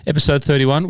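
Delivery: episode number called out high and loud; upbeat announcement. Real, not acted. Host to listener, calling out the episode number with a high, loud lift.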